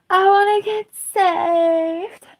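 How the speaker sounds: mock-pitiful little voice; pleading squeak. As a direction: beg to be rescued in a tiny voice. Playful plea aside.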